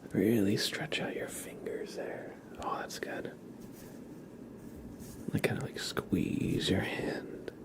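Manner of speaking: breathy ASMR whisper, close to the mic. Real, not acted. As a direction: whisper the stretches gently to the listener. ASMR whispered stretching guidance.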